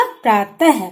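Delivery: usual speaking voice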